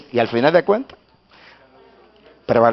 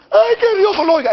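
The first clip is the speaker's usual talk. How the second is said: very high mock wail; imitating crying woman; 6 dB louder than usual. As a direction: act out the weeping mother's plea. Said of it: "Y ella me dijo llorando". (madre llorosa, imitada con voz aguda)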